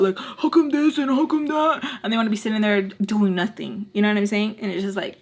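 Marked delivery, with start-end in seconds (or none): 0.2-1.8 high-pitched imitated whining complaint; mock a griping guy in a put-on high voice; mock bitching. Guy complains while doing nothing, mocked high griping. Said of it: "a guy's bitching about".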